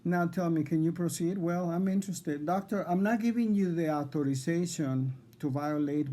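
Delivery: heated protest at the doctor; agitated demand. Real, not acted. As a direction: push back hard, voice up and loud.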